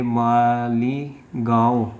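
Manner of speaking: Malegaon stretched out slowly, syllable by syllable; slow dictation. Real, not acted. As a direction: draw out Malegaon as if writing it. Spelling out place while writing. (Malegaon को धीरे-धीरे खींचकर बोलना)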